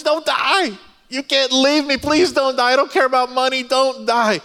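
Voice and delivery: high-pitched, wailing imitation of sobbing; mock ugly crying. Husband imitating his wife begging him not to die, mock ugly crying. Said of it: "She's ugly crying in this moment".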